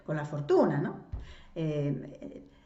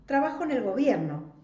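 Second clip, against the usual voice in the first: raised pitch, proudly declared; quick, haughty clarification; arrogant; 7 semitones higher, 4 dB louder than usual. A narrator's reading. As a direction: state your position with a touch of arrogance. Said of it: "se apresuró a aclarar arrogantemente".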